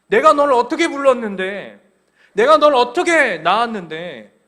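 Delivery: loud, pleading parent's reproach; loud reproach from God as a parent; reproachful parent. Acted. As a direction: demand to be remembered, like a hurt mother.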